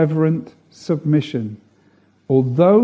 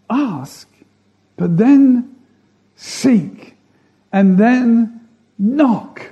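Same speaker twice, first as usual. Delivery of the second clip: each word pushed harder, ask to a louder KNOCK; building intensity; 7 semitones higher, 4 dB louder, slower than usual. Shown as a demonstration. Preacher to congregation, building from ask to knock. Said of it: "So build up your intention".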